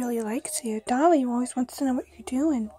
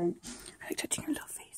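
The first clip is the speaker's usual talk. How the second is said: soft unvoiced whisper; 7 semitones lower, 14 dB quieter than usual. Real, not acted. Whispering beside the sleeping baby.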